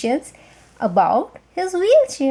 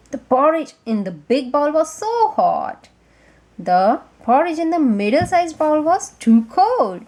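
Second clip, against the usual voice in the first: usual speaking voice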